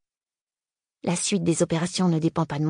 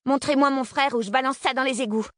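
defiant shout; 8 semitones higher than usual. Acted. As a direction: yell the threat across the dark. Girl threatens gunman.

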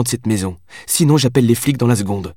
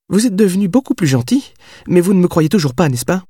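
put-on higher, knowing young woman; young woman's voice; 10 semitones higher than usual. A narrator's reading. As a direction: tease him gently with a question. Heroine probes the writer.

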